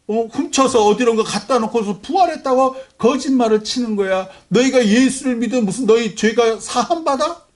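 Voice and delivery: high, loud scornful jeering; mocking Romans. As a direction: jeer in disbelief like a scoffing crowd. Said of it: "로마인들이 조롱하는 거예요".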